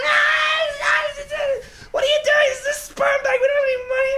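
very high, loud mock-terrified shriek; panicked woman. Sperm bank clerk facing the gunman, hammed-up panicked shriek. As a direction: squeal it like a hostage in a panic. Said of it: "And she's like".

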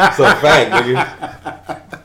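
laughing it out loud. Real, not acted. Teasing his friend over the team pick, laughing.

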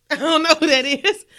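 giggling through the denial; laughing denial. Retold or imitated. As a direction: laugh it out, playing innocent. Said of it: "was like".

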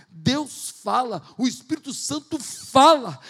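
normal talking voice

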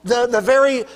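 loud, high, impassioned sermon voice; fiery preaching. Acted. Pastor pleading with the congregation, loud and impassioned. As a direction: preach it out with full force.

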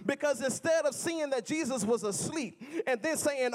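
ordinary delivery

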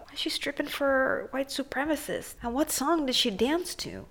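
quiet, conspiratorial questioning; hushed aside. Pretend. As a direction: wonder aloud under your breath.

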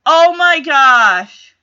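loud, slow drawn-out oh my gosh; aghast outburst; shocked. Real, not acted. Shocked reaction to the affair.

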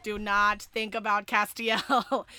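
hammed-up loud decree; mock propaganda order. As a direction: order the fans to forget him. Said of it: "They were like".

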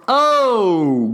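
normal talking voice

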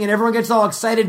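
ordinary talk, nothing marked

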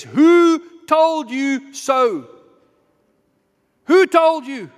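loud, high, drawn-out demand; booming confrontational question from the pulpit; God's challenge. Acted. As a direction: call it out slowly, each word ringing. Acts out God confronting the hiding.

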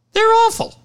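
squealed very high and loud; a teasing shriek at the joke; mock squeal. Real, not acted. Host ribbing his kid's JV team with a squeal. Joking about Helix JV team.

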